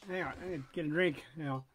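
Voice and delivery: plain reading voice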